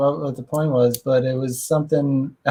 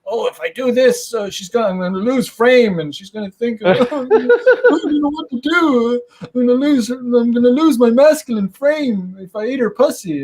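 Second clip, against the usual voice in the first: high, whiny mock of a nervous guy fretting; whiny mock insecure guy; 6 semitones higher than usual. Mocking an insecure guy about what she'll think, high and whiny. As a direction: mimic a scared guy in a high fretful voice. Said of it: "and not like".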